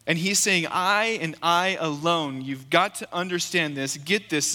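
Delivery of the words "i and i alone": slow, deliberate I and I alone; emphatic declaration. Acted. Pastor driving home Jesus's words to listeners, slow and emphatic. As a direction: say it as an absolute claim. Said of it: "This is strong language".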